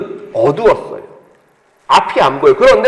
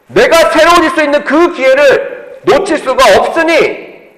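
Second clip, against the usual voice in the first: ordinary talk, nothing marked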